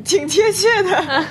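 laughing. Real, not acted. Laughs while agreeing.

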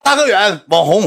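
high, loud fan calling out his name; excited fan calling. Retold or imitated. 粉丝在飞机上喊他. (兴奋粉丝高声大喊)

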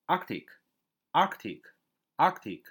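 "Arctic" slowly, first syllable stressed high. Shown as a demonstration. Teacher shows how to read Arctic, front-stressed, slow "Arctic". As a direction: model "Arctic" word by word, punching its opening syllable. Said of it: "重音在最前面".

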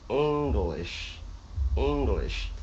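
"English" slowly, syllables linked with short i; syllables joined correctly. Shown as a demonstration. Teacher models the word for students, "English" slow and linked. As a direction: blend "English" slowly as "ing-glish". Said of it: "连在一起".

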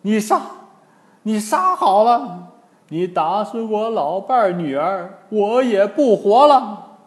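high, wailing challenge; acted anguished protest; desperate old man's dare. A narrator's reading. Bereaved old man dares Wukong.

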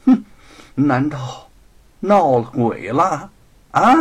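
puzzled master, loud drawn-out question; surprised. Acted. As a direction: ask it in open astonishment. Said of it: "惊奇地说". (惊奇地反问)

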